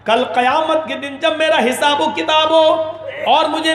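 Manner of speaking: high, intense sermon cry; impassioned preaching. Acted. Preacher voices the repentant cousin pleading with his sister. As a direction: plead with rising fervour.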